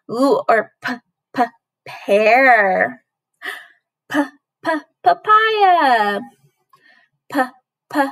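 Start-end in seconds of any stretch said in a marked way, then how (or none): none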